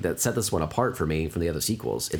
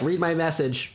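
robot voice; 7 semitones higher than usual. Retold or imitated. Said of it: "a computer voice say it".

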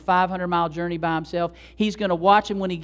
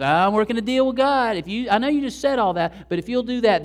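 louder, higher scheming bargainer; scheming Jacob; 6 semitones higher, 6 dB louder than usual. Retold or imitated. Jacob bargaining with God, loud scheming voice. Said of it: "he's the schemer again".

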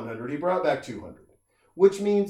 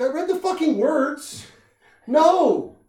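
indignant retort; 13 semitones higher, 7 dB louder, slower than usual. Retold or imitated. Woman snaps back at guy.

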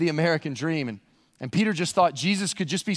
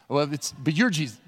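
ordinary delivery

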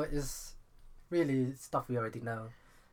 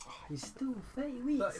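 high teasing voice; 12 semitones higher than usual. Acted. Teasing squeaky voice.

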